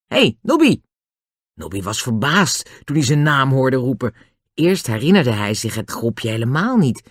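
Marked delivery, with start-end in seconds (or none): 0.1-0.8 high-pitched call to a friend; bright hail across a distance. A narrator's reading. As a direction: call out to get his attention. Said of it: "Julian riep hem".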